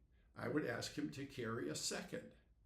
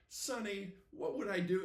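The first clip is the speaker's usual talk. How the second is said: higher, louder retold fretting; worried plea; 8 semitones higher, 5 dB louder than usual. Reluctant buyer pleads with the insurance salesman, worried. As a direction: plead as if overwhelmed by what-ifs.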